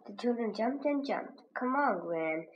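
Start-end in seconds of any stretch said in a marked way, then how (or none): none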